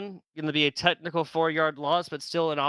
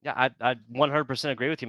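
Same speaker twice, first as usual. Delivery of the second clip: normal talking voice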